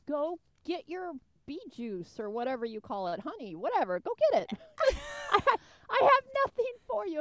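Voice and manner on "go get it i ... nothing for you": high-pitched mock pleading, loud and amused; playfully exasperated, voice pitched way up; exasperated shooing. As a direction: send the bee off in a squeaky-high plea. Mock-pleading with the bees, laughing.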